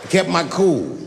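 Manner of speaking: usual speaking voice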